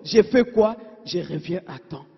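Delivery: ordinary talk, nothing marked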